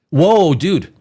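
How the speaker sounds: usual speaking voice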